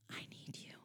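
breathy near-silent whisper. A narrator's reading. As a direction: barely breathe the words. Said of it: "I whispered".

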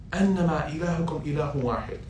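fast flowing recited Arabic; Quran recitation. Acted. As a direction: recite it in one smooth breath. Recites verse again.

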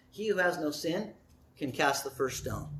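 low, soft and measured; softly spoken, calm authority; gentle quiet answer. Acted. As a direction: let the pause hold, then speak softly. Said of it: "That was a gentle word".

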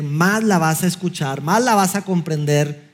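normal talking voice

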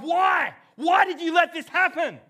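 loud, high-pitched shout of protest; anguished cry to God. Acted. Preacher voicing a sufferer crying out to God, anguished shout. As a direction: cry out at full force, demanding an answer. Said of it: "you've cried out to God".

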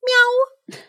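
high-pitched imitated mew; cat meow. A narrator's reading. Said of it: "said the green-eyed cat".

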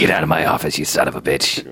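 low, lightly voiced old tycoon; Robert Loggia. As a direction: growl it like an angry movie boss. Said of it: "So Robert Loggia was the producer on this?".

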